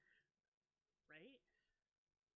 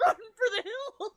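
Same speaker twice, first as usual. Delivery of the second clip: laughing; 10 semitones higher, 7 dB louder than usual. Real, not acted. Laughing at own panic.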